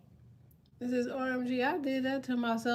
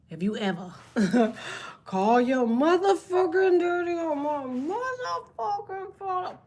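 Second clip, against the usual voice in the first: higher, louder, slowed scold; mock scolding; 7 semitones higher, 4 dB louder than usual. Aunt scolding the nephew who called his dad, mock anger. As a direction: dress the kid down like an angry aunt.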